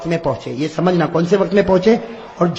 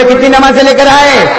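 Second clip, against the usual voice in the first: asked again louder to rouse the crowd; louder repeat; 7 semitones higher, 7 dB louder than usual. Shown as a demonstration. Preacher repeating the question louder for the audience to answer. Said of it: "آواز سے بولو نا".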